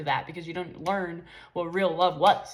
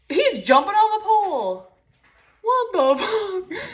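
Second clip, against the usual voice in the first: real startled yell, higher and louder; startled outburst; 11 semitones higher, 8 dB louder, slower than usual. Real, not acted. Speaker sees her pet jump on the pole: startled louder yell. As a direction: blurt it out in shock.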